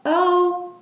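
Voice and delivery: a frail, startled little oh; tremulous. Acted. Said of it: "Nellie's tremulous voice".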